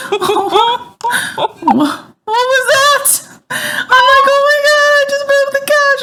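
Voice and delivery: high, laughing and shocked; laughing disbelief. Retold or imitated. Mom retelling breaking the couch, high laughing squeal.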